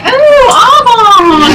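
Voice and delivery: drawn-out, high, loud Australian greeting; Australian accent. Retold or imitated. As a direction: stretch it out slowly in a big high Aussie voice. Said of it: "she does her Australian voice".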